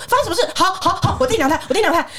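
high-pitched bewildered compliance; flustered husband. Retold or imitated. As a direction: imitate a husband hastily giving in. Acting confused husband obeying father. (慌张地连声答应)

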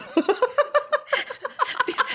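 high burst of laughter. Real, not acted. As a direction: laugh at the joke. (高声大笑)

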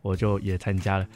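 normal talking voice

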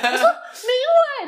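put-on child's wail. 小孩对老师哭喊明伟的名字. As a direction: wail the name like a hurt kid. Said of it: "我说".

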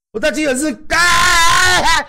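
high, loud pained screams of a shot man; loud hospital-bed screams; pained screaming. Retold or imitated. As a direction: cry out like you've just been shot. Retelling screaming after being shot.

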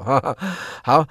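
usual speaking voice

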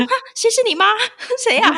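high, loud laughing mock outrage; mock outraged retort. Mother retelling her outrage at a clerk calling her mom, high and laughing. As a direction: squeal it in laughing disbelief. Retold indignant reaction, laughing.

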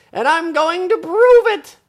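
very high, loud boast; determined story boy. Acted. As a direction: vow it at the top of your voice. Boy in story vows proof.

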